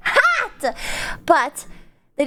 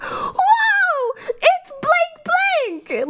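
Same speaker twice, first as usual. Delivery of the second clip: much higher, slow drawn-out squeal; excited fan shout; much slower than usual. Retold or imitated. Fans shouting her name across the con center, squealing. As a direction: gasp out the name like a thrilled fan. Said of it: "they'd be like".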